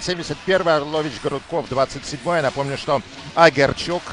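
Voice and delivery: ordinary delivery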